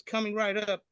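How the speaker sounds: high, cheerful shop clerk. Retold or imitated. Clerk handing over the [name] CD, high. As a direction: chirp it like a helpful clerk.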